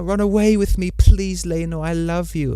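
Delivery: high, begging falsetto lover; pleading lover. Pretend. Mocking Laenor's lover, high and begging. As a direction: plead like a lovesick suitor.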